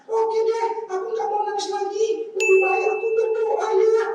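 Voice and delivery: put-on high child voice, brightening; a little girl deciding to pray; cheered-up little girl. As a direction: puppet girl finding her courage. Caca cheered up.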